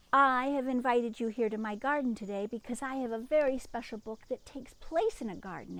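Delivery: plain reading voice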